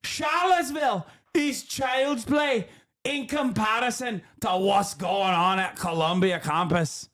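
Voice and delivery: high, slow, shouted mock Scottish voice; Scottish accent impression. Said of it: "my impersonation of her".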